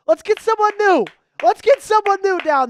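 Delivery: high and loud rallying call; hyped call-out. Real, not acted. Host rallies stream chat to comment, high and loud. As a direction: pump up the chat.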